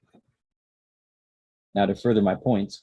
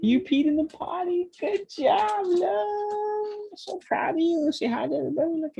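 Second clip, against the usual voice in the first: potty training praise. Real, not acted.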